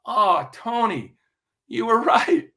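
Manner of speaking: slow, high and tender, a laugh breaking through; heartfelt realization. Acted. Magician speaking to his late mentor, slow tender realization.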